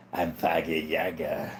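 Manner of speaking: low, soft mock Russian voice; imitated Russian accent. Livestreamer imitating a dad who does Russian, Russian accent. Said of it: "A below average Utah dad. He's pretty good".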